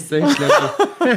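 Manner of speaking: laughing. Real, not acted. Laughs at her own faces.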